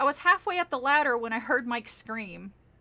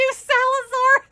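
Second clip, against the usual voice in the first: surprised; 12 semitones higher, 11 dB louder, slower than usual. Real, not acted. Laughing at chat.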